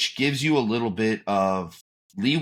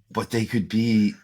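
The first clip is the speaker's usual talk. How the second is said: ordinary talk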